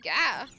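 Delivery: sudden loud glitch cry; robot breakdown yelp. Acted. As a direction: yelp as the circuits fail.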